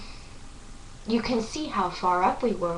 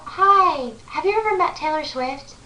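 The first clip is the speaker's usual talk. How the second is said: high, lifted voice imitating the girls; louder, sing-out greeting in a raised pitch; retold friendly greeting; 8 semitones higher, 5 dB louder than usual. Two girls walk up to a fan, high retold greeting.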